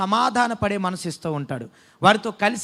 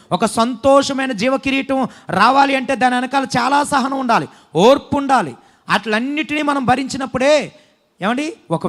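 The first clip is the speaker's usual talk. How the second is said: louder preaching build; rising sermon; 3 semitones higher, 5 dB louder than usual. Acted. Pastor urging the congregation toward patience, preaching loud. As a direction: drive the point home to the church.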